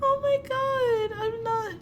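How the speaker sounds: high, slow, breathy giggles; helpless giggling. Real, not acted. Reader swooning over the boy she loves. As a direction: let the giggles break the words.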